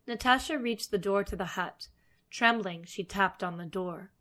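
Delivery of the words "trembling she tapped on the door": plain reading voice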